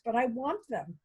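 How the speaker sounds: ordinary talk, nothing marked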